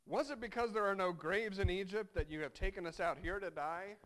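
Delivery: sarcastic. Acted. Israelites mocking Moses about the graves, loud sarcasm. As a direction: throw the accusation with biting sarcasm. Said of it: "there's sarcasm in the Israelites".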